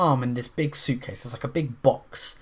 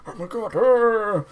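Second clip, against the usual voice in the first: panicked; 8 semitones higher, 4 dB louder, slower than usual. Retold or imitated. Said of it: "He's like".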